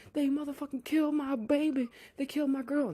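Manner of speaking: high-pitched mock distress; hammed-up, high-pitched upset outburst; imitated freak-out. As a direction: act out a nun freaking out. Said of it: "the nun's freaking out".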